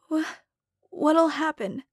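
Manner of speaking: high, breaking voice near tears; a scared girl fighting back tears; faltering, teary question; close to tears. Acted. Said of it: "lip quivering. She was close to tears".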